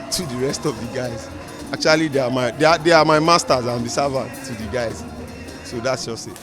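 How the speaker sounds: normal talking voice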